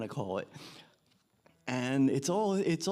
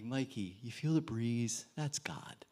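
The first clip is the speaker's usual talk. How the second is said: soft, low, sinking into a whisper; hushed tenderness; 4 semitones lower, 8 dB quieter than usual. Retold or imitated. Counselor calming a shaken little boy at the stump, hushed tenderness. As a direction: softly share a wonder with a child.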